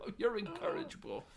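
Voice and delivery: high, prim scolding mom voice; exasperated mom. Retold or imitated. Mom giving up on her son, in a high prim voice. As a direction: sigh it out like a fed-up mother. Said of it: "his mom's just like".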